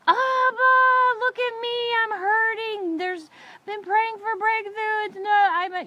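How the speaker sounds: high-pitched, hammed-up wailing plea; loud high wail that runs on into pleading; acted crying out. Woman in meltdown begging her heavenly daddy, mock wailing cry. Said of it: "I'm crying daddy to my heavenly daddy".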